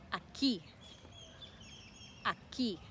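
aquí with weight on KÍ; teacher's model "aquí", last syllable stressed; stress on final syllable. Shown as a demonstration. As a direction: land on the "quí" of aquí. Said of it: "Aquí is the emphasis".

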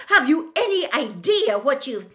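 high, loud, outraged demand; full-volume angry scolding; furious bellow. A narrator's reading. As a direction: bellow the question, cut off midway. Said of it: "bellowed Professor McGonagall".

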